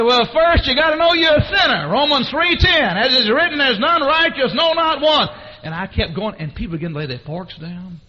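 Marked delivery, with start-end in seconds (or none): 0.0-5.3 high, loud shouted preaching; yelling across room. Retold or imitated. Man preaches to friend over the diners, yelling. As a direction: holler scripture across a full room. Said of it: "yelling across the people".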